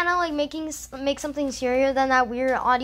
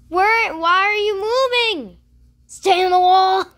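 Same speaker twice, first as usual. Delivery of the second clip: alarmed yell; 7 semitones higher, 8 dB louder than usual. Real, not acted. Yelling at game character.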